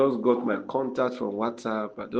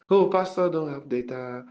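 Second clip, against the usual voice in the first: mock pitying voice; 3 semitones higher than usual. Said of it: "Some people say".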